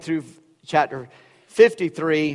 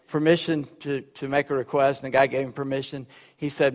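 ordinary talk

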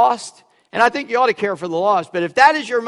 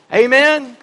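ordinary talk, nothing marked

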